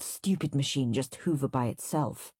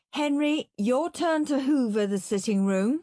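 mum's voice; 8 semitones higher than usual. A narrator's reading. Mum calling Henry to chores.

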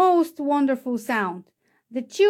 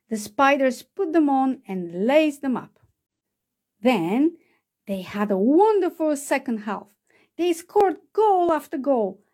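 ordinary delivery, nothing marked

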